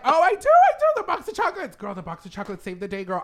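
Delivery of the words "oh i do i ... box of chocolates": high, loud, sudden relief; replaying her own excited realization, much higher and louder. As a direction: cry out as the answer hits you. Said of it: "and I was like".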